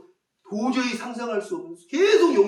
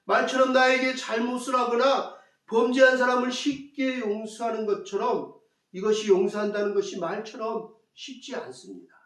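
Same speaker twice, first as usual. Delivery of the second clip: ordinary talk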